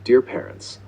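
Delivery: ordinary talk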